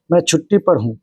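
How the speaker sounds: ordinary delivery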